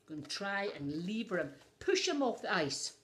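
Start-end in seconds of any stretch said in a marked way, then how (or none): none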